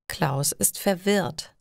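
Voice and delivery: plain reading voice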